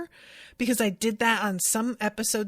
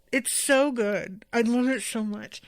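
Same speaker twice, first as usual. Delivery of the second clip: ordinary talk, nothing marked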